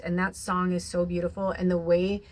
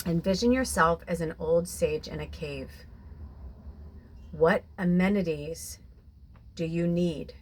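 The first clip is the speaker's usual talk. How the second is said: guided meditation. Acted. Guided visualization.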